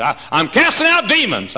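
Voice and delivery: high, loud mock proclamation; boasting preacher. Another preacher bragging of casting out demons, loud high imitation. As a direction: imitate a preacher announcing his feats. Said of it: "he said".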